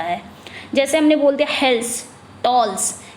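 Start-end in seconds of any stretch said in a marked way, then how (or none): 1.5-3.0 "हेल्स" and "tolls" each given full stress; stressed final syllable. Shown as a demonstration. Teacher showing students a masculine rhyme, stressing "हेल्स, tolls". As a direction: land firmly on each one-syllable word, "हेल्स, tolls". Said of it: "stress करना पड़ रहा है".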